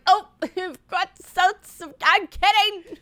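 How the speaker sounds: high, slow, embarrassed laughing backpedal; nervous laugh. Retold or imitated. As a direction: laugh it off awkwardly. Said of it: "I was like".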